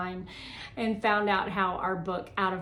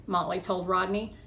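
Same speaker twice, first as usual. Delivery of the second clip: usual speaking voice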